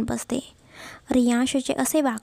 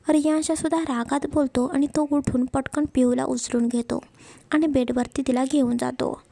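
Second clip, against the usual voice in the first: ordinary delivery, nothing marked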